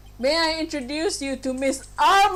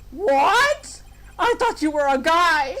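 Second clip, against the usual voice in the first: usual speaking voice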